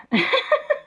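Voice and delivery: a shrill, very high burst of laughter; high squeal of laughter. Real, not acted. Laughing at the cousin's answer.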